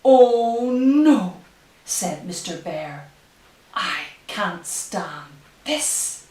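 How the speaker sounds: slow, hushed storybook reading; reading to children. Acted. Reads picture book aloud.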